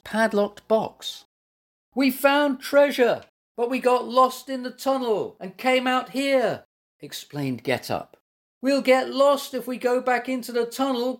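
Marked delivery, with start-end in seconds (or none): 2.0-6.6 high, raised calling voice; calling across. A narrator's reading. Lost animal calls across the stream. 8.6-11.2 high, sustained calling voice. A narrator's reading. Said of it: "called Stripey".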